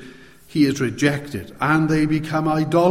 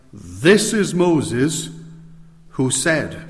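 This hit hard, slow and deliberate; preacher stressing This Moses, this man; emphatic stress on This; much slower than usual. Shown as a demonstration. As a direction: lean on each This with a pause after it. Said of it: "I can emphasize it like this".

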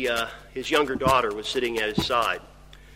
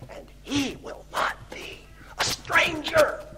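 high, intense half-whisper; hushed awe; 12 semitones higher, 4 dB quieter than usual. Acted. Preacher voicing Job's hope of seeing God, hushed and intense. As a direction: whisper it, then lift high on "a stranger".

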